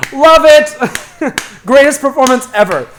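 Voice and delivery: high, loud shouted rave; hammed-up bravo shout; mock acclaim. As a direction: cheer it like an overdone audience member. Cliff loudly cheering the audition.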